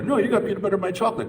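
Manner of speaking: put-on old commercial reply. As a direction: toss the line back like the ad's second actor. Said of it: "like that old commercial".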